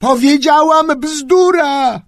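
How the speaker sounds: high, loud, slow shout of bzdura; girl bellowing at a deaf grandfather; shouting into his ear. A narrator's reading. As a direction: yell it right into grandpa's ear. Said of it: "huknęła mu wprost do ucha".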